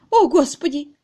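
high, loud cry of dismay; wailing. A narrator's reading. Miss Twitterton dreading the master's anger, wailing. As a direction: lament helplessly.

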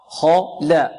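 isolated syllables, heavy خا then light لا; dark خا, thin لا, one at a time; تفخيم ثم ترقيق. Shown as a demonstration. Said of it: "الخاء مفخمة واللام مرققة". (خا مفخمة ولا مرققة)

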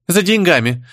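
high, abrupt comeback; sharp retort. A narrator's reading. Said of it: "не менее резко ответила Сопля".

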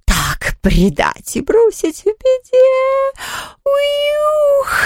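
very high, slow ghost wail. A narrator's reading. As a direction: wail it out, long and heartbroken. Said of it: "горестно вопил Хуга".

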